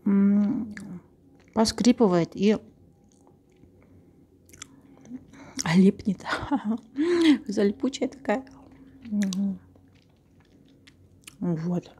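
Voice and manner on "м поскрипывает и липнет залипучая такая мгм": talking while chewing; words muffled by food; mouth full, chewing. Real, not acted. Seen 1: Lips pressed, cheeks working between words; bar sits on table.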